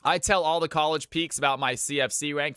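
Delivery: usual speaking voice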